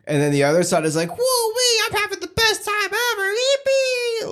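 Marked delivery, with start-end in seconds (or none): 1.2-4.3 very high, gleeful squeal; giddy squeal. Retold or imitated. Imitating the overjoyed partner with a gleeful high squeal. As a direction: squeal with joy like a kid on a ride. Overjoyed side of couple voiced.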